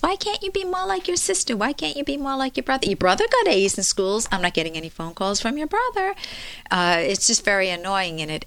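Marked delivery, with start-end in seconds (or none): 0.0-6.1 high-pitched mock nagging parent comparing siblings. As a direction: scold the kid like a fed-up mom, fast by the end. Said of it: "They can make comparisons".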